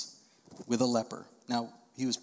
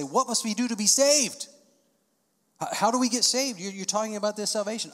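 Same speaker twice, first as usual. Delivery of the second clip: eager crowd question; 7 semitones higher, 4 dB louder than usual. Retold or imitated. Crowd asking Peter, acted out.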